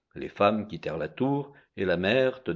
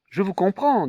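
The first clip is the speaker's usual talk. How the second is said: mother's plea; 10 semitones higher, 4 dB louder than usual. Acted. Mother answers the women, distressed.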